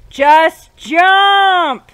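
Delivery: high, loud, drawn-out command; cranky shout. Real, not acted. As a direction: yell it in exasperation at the controls. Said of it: "sorry if I sound a little cranky".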